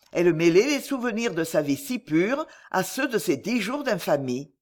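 ordinary delivery, nothing marked

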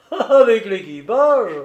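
laughing, high and loud; gleeful. A narrator's reading. Keeper adding the crew would perish, gleeful. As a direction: stretch it out through a merry laugh. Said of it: "ajouta gaiement Simon".

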